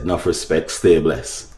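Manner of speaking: ordinary delivery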